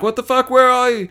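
high startled cry of alarm; shocked. Retold or imitated. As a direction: friends find the toilet empty, calling in shock. Friends finding empty portaloo.

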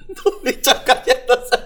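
words squeezed out through a high laugh; slow giggling delivery; laughing while talking. Real, not acted. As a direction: laugh the line out slowly. Laughing at a video clip.

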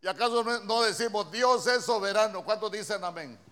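loud, urgent pulpit preaching; fiery sermon climax; preaching at full force. Acted. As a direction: hammer the point to the congregation. Preacher challenges congregation.

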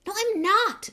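high, loud angry denial; indignant outburst. Acted. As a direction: protest hotly, voice jumping up. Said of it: "said Harry angrily".

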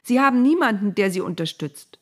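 high, loud protest; heated outburst. A narrator's reading. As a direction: argue back passionately. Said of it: "rief Mose dazwischen".

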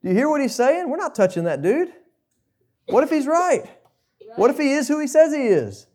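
high, loud, alarmed guards backing away; panicked officers. Retold or imitated. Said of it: "They're basically saying".

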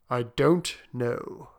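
DON'T KNOW drawn out slowly; slow pondering. Acted. Soldier unsure about wishing again, slowly pondering "don't know". Slower, pondering repeat of reply.

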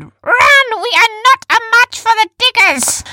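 very high, loud cry of alarm; panicked yell. A narrator's reading. Heroine ordering everyone to flee, panicked high yell. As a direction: scream the warning out. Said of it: "Jumba panicked".

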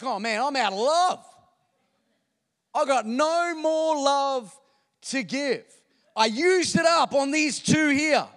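loud, slow, anguished cry of a worried parent; mock despair, stretched out and shouted; overwhelmed parent. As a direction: play the frazzled parent who thinks love ran out. Parent fretting over third child.

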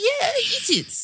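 slow, high and laughing; drawn-out giggly yes; laughing mum voice. Retold or imitated. Mum's reply, laughing.

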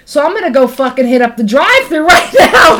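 loud and laughing; rising, excited self-talk. Retold or imitated. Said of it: "So now you're like".

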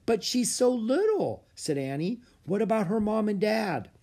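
high pleading worry; concerned. Acted. Annie to the emperor about the baby, high worried. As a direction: protest gently, she's too small.

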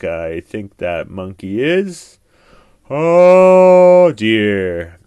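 put-on a knowing sigh from an old lion. A narrator's reading. Old lion grumbles about the monkey, slow and loud.

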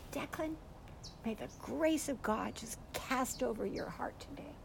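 put-on soft, gentle blessing over a child; hushed, loving pronouncement; tender blessing. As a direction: speak it softly, just to one child.

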